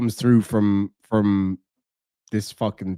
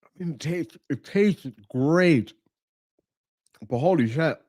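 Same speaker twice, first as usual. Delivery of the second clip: mouth burning; 2 semitones higher than usual. Real, not acted. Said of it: "It's making my eyes water".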